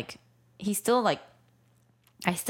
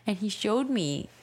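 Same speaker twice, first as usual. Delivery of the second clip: usual speaking voice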